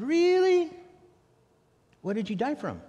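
high, loud, disbelieving really; incredulous. Retold or imitated. Cynical preacher to man claiming death, incredulous high. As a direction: squeal out the doubt. Skeptical American questioning the man.

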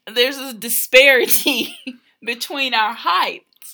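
real laughter breaking into the words; amused, laughing. Real, not acted. Wife explaining the couple's height difference, laughing.

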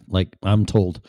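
ordinary talk

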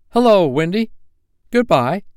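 put-on high, loud boyish greeting; breezy Peter Pan boy. A narrator's reading. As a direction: toss it out as a boy passing by. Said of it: "he said".

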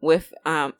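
ordinary talk

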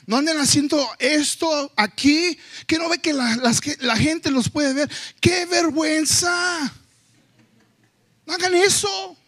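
high, rising parental scolding that builds to a shout; exasperated dad hushing his kids in public. Retold or imitated. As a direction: tell the noisy kids off in front of everyone. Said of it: "les tengo que decir".

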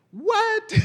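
very high, disbelieving "what?"; astonished what. Real, not acted. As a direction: gasp it out in amazement. Astonished at the verse.

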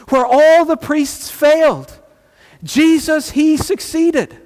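high-pitched, emphatic proclamation; triumphant preaching. Real, not acted.

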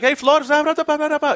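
high, loud gibberish Spanish chatter; imitating Rincon. Rincon chatting to the rookie in Spanish, high mock voice. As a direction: rattle off fast mock Spanish. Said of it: "and he's like".

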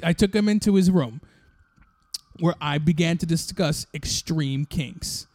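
ordinary talk, nothing marked

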